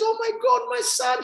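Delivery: very high-pitched mock mom, overwhelmed; hammed-up high mother's cry of joy; tearful mother. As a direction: play an overjoyed mom, voice way up high. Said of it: "She starts crying".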